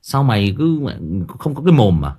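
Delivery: loud, exasperated telling-off of a son; scolding parent. Retold or imitated. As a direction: scold the teenage boy hard. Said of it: "mình phải quát". (cha mẹ quát mắng đứa con trai, to tiếng)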